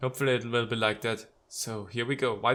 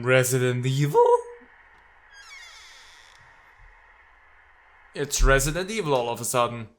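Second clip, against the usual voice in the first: surprised; 5 dB louder than usual. Real, not acted. Game suddenly turns creepy.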